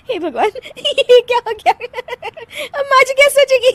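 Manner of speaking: high-pitched fretting broken by laughter; uncontrollable giggling. Acted. Daughter-in-law frets about mother-in-law's opinion, laughing helplessly. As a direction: worry aloud while unable to stop laughing. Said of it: "हंसने वाली दवा खा लेती है".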